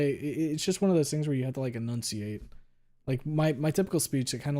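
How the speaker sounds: ordinary talk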